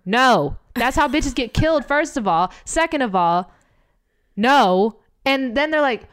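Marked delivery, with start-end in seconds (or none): 0.1-0.6 loud, punched one-word refusal; firm, exasperated no; emphatic refusal. Real, not acted. Firm rejection of retold message. 4.4-5.0 loud, punched one-word refusal; firm, exasperated no; emphatic refusal. Real, not acted. Firm rejection, second time.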